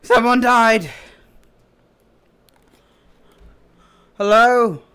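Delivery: calling out. Acted. Calling out alarmed.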